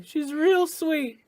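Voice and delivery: mother breaking down over her daughter, crying out high; starting to cry. Acted. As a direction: let it crack as the tears come. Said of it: "And she starts to cry".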